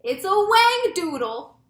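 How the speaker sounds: high, loud, stretched-out yell; slow, full-voiced alarm cry. A narrator's reading. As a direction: yell the monster's name in alarm. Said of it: "yelled the head of the fire department".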